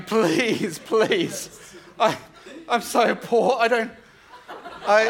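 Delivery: hammed-up tearful begging from a broke student; blubbering art student. Art student begging the driver he hit, mock blubbering plea. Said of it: "you encounter this blubbering wreck".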